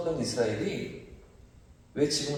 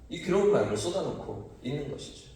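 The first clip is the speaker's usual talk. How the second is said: ordinary delivery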